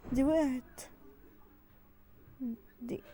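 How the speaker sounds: ordinary talk, nothing marked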